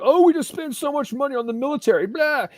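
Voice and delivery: sneering mock voice; mocking morons. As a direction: parody the people you scorn. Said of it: "freaking morons run around talking about".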